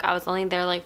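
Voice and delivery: ordinary delivery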